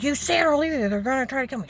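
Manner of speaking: high, loud re-enacted quarrel; heated argument. Two people arguing over threats, heated shouting. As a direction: raise voice sharply as in a row.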